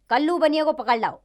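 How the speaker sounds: loud, high-pitched order; royal command. Acted. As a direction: order your guards out at once. King orders an arrest.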